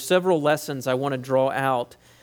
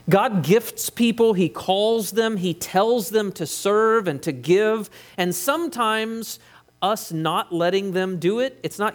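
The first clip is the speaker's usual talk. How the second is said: slow, raised preaching; slow, lifted pulpit emphasis; 5 semitones higher, slower than usual. Real, not acted. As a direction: drive home each clause slowly. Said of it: "emphatic sermon point".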